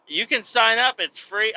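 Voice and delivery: high, chirpy cashier; mock chirpy cashier pushing sign-up. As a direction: pitch up to play the cashier. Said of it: "She's like".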